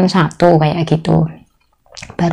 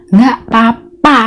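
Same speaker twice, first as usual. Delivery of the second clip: cheerful employer; 5 semitones higher, 3 dB louder than usual. Retold or imitated. Employer's cheerful reply, laughing.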